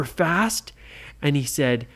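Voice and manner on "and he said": ordinary delivery, nothing marked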